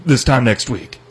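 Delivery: ordinary talk, nothing marked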